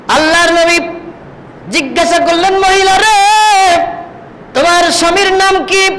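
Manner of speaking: high-pitched sermon delivery; impassioned preaching. Acted. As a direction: preach it high and urgent, lines held up at the top.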